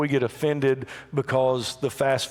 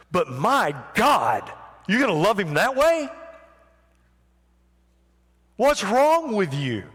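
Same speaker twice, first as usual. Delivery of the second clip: mock scorn; 7 semitones higher than usual. Onlookers mocking the woman for loving Jesus that way, higher scornful disbelief. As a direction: sneer at her devotion. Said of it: "onlookers' scorn voiced".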